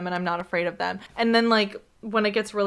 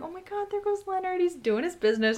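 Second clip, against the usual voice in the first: high, lightly mock delighted kid voice; homeschooled kids spotting their butterfly; cheerful greeting; 9 semitones higher than usual. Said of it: "we're like".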